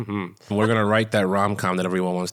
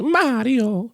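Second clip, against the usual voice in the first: "Mario" sung out high in a cartoon Italian accent; a quick jab of the Italian accent; mock Italian accent; 14 semitones higher, 4 dB louder than usual. Teasing a friend with the Mario name in a mock Italian accent. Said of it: "he still goes".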